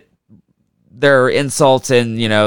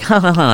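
higher-pitched spoken ha-ha-ha; mock snicker; 5 semitones higher than usual. General Pershing gloats over his trick, mock snicker. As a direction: fake a gleeful little snicker, pitched up. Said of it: "like, snickered and kicked his feet".

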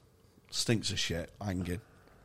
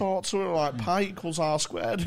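mock nerdy student; 7 semitones higher than usual. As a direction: send up a shy maths geek who can't talk to girls. Mocking a nerdy jumper wearer.

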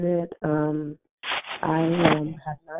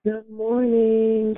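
slow, higher stretched good morning; drawn-out greeting; 5 semitones higher, 4 dB louder, much slower than usual. Real, not acted. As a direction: stretch it out slowly. Host greets caller.

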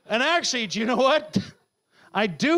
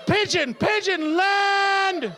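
yelling at the bird; 13 semitones higher, slower than usual. Retold or imitated. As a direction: yell it like you're begging the pigeon to land. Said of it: "stood out there going".

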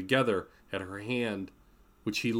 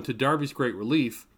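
usual speaking voice

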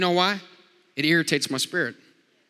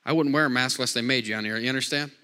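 ordinary delivery

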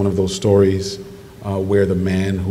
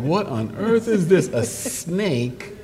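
mock disbelief; 8 semitones higher, much slower than usual. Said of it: "I was like".

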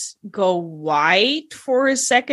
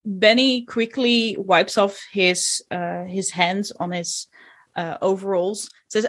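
ordinary talk

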